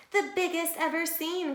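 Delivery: high squeaky quacking duck voice. A narrator's reading. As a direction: pitch it way up like a little duck. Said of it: "quacked Duck".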